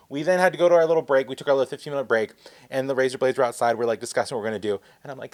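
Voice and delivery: ordinary delivery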